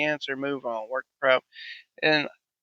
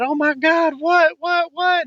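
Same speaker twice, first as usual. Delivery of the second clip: high, louder alarmed teacher voice; shocked teacher; 13 semitones higher, 5 dB louder, slower than usual. Retold or imitated. Teacher reacting to the boy at lunch, high and alarmed. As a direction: play the teacher, startled. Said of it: "the teacher said".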